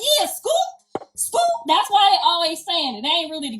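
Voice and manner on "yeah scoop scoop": very high rising Scoop cry; high gang call. Retold or imitated. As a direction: throw up the call high and excited. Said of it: "He like".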